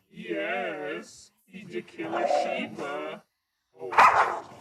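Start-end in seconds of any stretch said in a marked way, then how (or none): none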